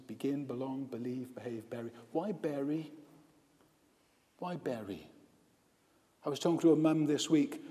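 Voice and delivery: ordinary delivery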